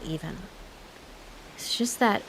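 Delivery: ordinary talk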